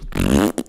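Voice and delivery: short buzzing raspberry; imitated raspberry fart sound; fart noise. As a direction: blow a raspberry with lips and tongue. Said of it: "He does a fart noise".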